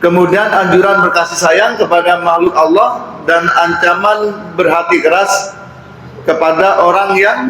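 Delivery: high, slow reading-aloud voice; formal text reading. Acted. Preacher reading a lesson on compassion to congregation, slow formal recitation.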